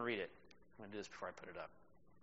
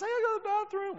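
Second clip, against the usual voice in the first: put-on much higher childlike plea; crying boy asking grandma to leave the table, high child voice; little boy's voice; 5 dB louder, faster than usual. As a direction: squeak it out like a hurt little boy. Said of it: "He says".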